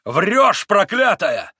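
high, loud enraged yell; furious yell. A narrator's reading. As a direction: roar at the lying old woman. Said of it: "завопил Митя".

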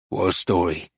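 low-pitched, terse man's voice; laconic painter. A narrator's reading. Painter stonewalls the dealer's question, laconic. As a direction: answer low and unhelpfully.

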